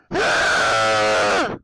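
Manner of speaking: loud shocked gasp; sharp gasp. Real, not acted. Gamer jolted by a sudden attack, gasping. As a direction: gasp hard, caught off guard.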